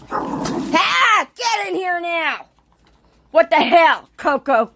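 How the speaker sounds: loud high yell. Real, not acted. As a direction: yell them inside. Yelling at dogs to come in.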